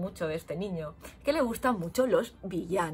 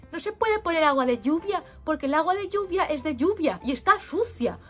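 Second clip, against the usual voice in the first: put-on know-it-all kid; 7 semitones higher, 4 dB louder than usual. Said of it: "y le dice".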